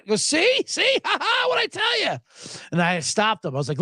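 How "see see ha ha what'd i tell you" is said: high, mocking "see, see" with a laugh; gloating laugh. Coworker gloating to his buddy over the joke, high and laughing. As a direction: crow over the point you just won, laughing.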